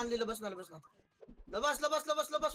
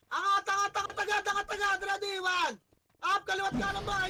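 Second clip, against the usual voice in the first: high, loud urgent commands, one after another; shouted calls; 5 semitones higher, 6 dB louder than usual. Real, not acted. Shotcaller urging teammates up and left-right in a clash, shouting. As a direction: yell the calls so the whole team hears. Shotcaller yelling pushes mid-match.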